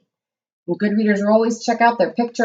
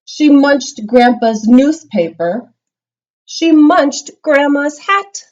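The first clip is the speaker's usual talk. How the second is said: each munch louder and higher; read-aloud build, louder each line; building storytelling; 5 semitones higher, 4 dB louder than usual. A narrator's reading. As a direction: pile up the mischief with rising energy.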